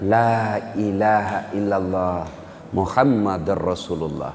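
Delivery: slow, low, effortful words; laboured recitation. Retold or imitated. Dying Alqamah is led through the creed, laboured recitation. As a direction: draw each word out low, as if struggling. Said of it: "dengan susah payah mengucapkan".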